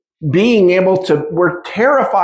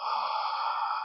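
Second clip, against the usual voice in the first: long breathy exhale through the mouth; out-breath demo for the listener; 16 dB quieter than usual. Shown as a demonstration. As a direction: breathe out audibly to show them. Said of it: "Take a deep breath in your nose".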